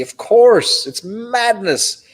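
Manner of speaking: high, drawn-out exclamation of mock outrage; mock exclaim. Real, not acted. Host reacts to the whiskey's price with mock disbelief. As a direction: stretch "madness" out in disbelief.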